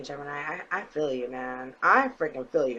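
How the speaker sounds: normal talking voice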